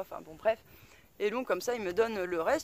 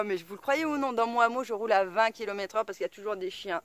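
ordinary talk

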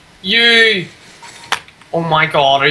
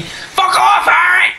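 furious yell; 11 semitones higher, much slower than usual. Real, not acted. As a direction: let it rip at him. Rage at teammate Harry.

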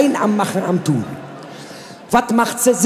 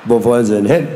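tiefe Männerstimme; 10 semitones lower than usual. Acted. Dialog Frau und Ruderer.

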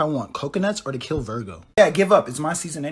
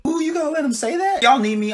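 excited; 11 semitones higher than usual. Acted. Stirring up drama.

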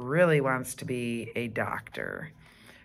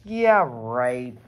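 lower, louder skeptical aside; a touch of a doubting mom; mom's doubtful thought; 4 semitones lower, 5 dB louder than usual. Acted. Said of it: "Judy's mom thinking".

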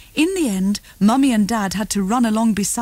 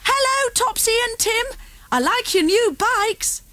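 put-on high child voice calling across the field; 13 semitones higher than usual. A narrator's reading. Girl on a stunt bike calls to the twins, high and carrying. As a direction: call out bright and high, greeting friends. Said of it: "One of them shouted".

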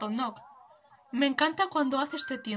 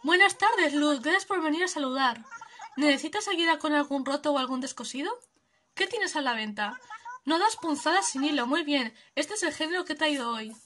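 lightly voiced cheerful seamstress, a bit higher; friendly shop greeting; shopkeeper; 4 semitones higher, 6 dB louder than usual. Streamer voicing Pili welcoming a customer, lightly cheerful.

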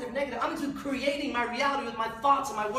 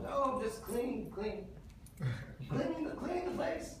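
softer, evasive mumble; sheepish excuse; 9 dB quieter than usual. Retold or imitated. Old man caught sneaking in, softer sheepish excuse. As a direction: play innocent, trailing off.

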